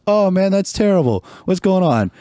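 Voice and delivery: bright polite concern for a stranger; trying to be friendly. Retold or imitated. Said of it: "still trying to be friendly".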